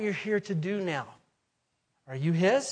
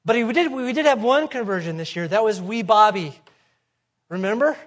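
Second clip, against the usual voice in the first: louder, higher retelling of a pastor's plea; retold pastor's protest; 5 semitones higher, 9 dB louder than usual. Pastor answering calls to resign, retold protest. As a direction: press the point loudly as the challenged pastor. Pastor defends his lean year.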